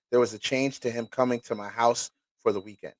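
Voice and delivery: normal talking voice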